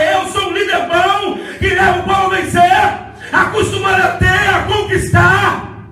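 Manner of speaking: loud, high, driving sermon shout; fiery preacher shouting over the music; preaching at full force. Real, not acted. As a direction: build the crowd up to a fiery peak. Preaching climax about Josué.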